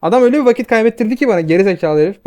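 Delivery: venting real fury at a teammate who stole his camp; furious rant. Real, not acted. Gamer raging at the thief who cost him a level, loud and furious. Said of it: "Kuduruyorum sinirden şu an".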